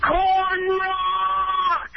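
high, long-held fan scream; full-lunged scream. Shown as a demonstration. Caller screaming for the hosts on request, long high yell. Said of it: "scream at the top of your lungs".

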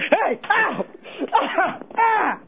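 hammed-up pained yelps; mock yelps of pain. Caller [name] pretending to get hurt on the phone, mock yelps. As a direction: fake ow cries.

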